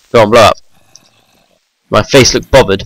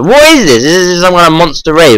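much higher, startled question; surprised; 7 semitones higher than usual. Real, not acted. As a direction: blurt it out startled. Surprised by monsters.

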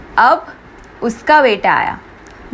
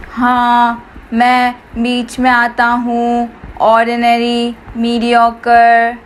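slow robot monotone; robotic, every word on one pitch; machine voice; slower than usual. Acted. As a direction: drone it out slowly like a machine. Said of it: "machine वाली आवाज़ में".

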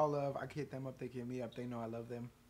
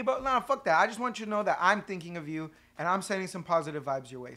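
ordinary talk

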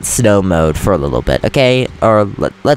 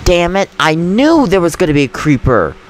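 real frustration; 7 semitones higher than usual. Real, not acted. Player whose room got blown up by a creeper, furious cursing. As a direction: vent real annoyance at the game. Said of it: "that pissed me off".

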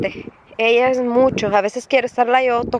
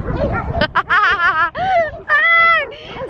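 laughing; 13 semitones higher than usual. Real, not acted. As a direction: laugh at herself. Se ríe tras gritar. (carcajada aguda)

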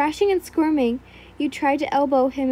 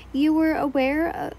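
usual speaking voice

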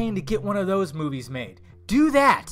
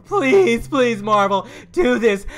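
high, loud whiny pleading; mocking fans pleading with Marvel, high and loud; mock begging fan; 5 semitones higher, 9 dB louder, slower than usual. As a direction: beg Marvel like a desperate fan. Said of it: "Nobody's begging Marvel".

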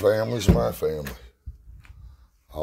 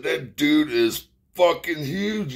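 higher, slow, awed son voice; imitating his son; 10 semitones higher, 3 dB louder, slower than usual. Son telling dad about the visitor, mock awed and higher. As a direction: drag it out, mildly amazed. Son's awed retold remark.

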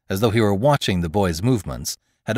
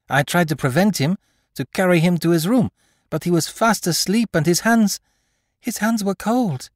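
high, louder, mounting worry; frightened nurse reporting to the mother; anxious nurse; 10 semitones higher, 5 dB louder than usual. Acted. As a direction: blurt it out in alarm, slowing on the last words. Nurse reporting the cold child.

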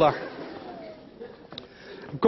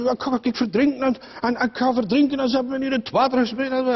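put-on whiny child; 8 semitones higher, faster than usual. Drowning boy wails in pool.

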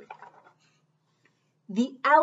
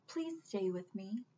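much softer, lower plea; 3 semitones lower, 13 dB quieter than usual. Acted. Taylor asks the rabbit to stay, soft plea. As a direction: ask it gently, barely there.

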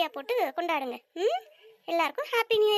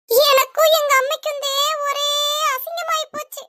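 outraged complaint; 6 semitones higher, 6 dB louder than usual. Acted.

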